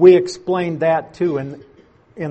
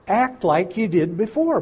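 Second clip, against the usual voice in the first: ordinary talk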